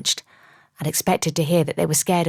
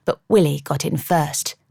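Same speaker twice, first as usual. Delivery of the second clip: ordinary delivery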